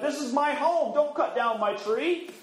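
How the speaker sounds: high, put-out little elf voice; lightly voiced, indignant elf. Elf pleads for his tree.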